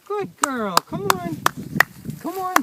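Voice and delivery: high, loud call to the dog. Real, not acted. Trainer calling his retriever back to him, loud and high. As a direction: call her in across the field, up and loud.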